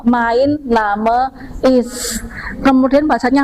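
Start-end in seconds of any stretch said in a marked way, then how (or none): 0.1-2.2 MEIN NAME IST slowly, Name as "NAH-muh"; German pronunciation. Shown as a demonstration. Teacher showing the class the German reading, slow German pronunciation. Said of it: "Jerman itu dibaca nama".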